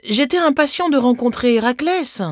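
put-on high, eager little boy's voice; lightly voiced pleading boy; flustered child's excuse. A narrator's reading. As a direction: explain yourself to a cross mother. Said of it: "bredouilla Thésée".

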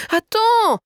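put-on very high, loud child's wail; shrieked, high child protest; protesting little girl. A narrator's reading. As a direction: squeal the demand like an insistent kid. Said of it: "Mais Sophia protesta".

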